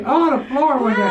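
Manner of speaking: excited squeal. Real, not acted. Excited ride on the elevator.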